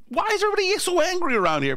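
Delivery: high-pitched exasperated protest; mock bewildered complaint. Fleeing diner baffled by everyone's anger, high mock protest. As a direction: plead innocence, pitched high.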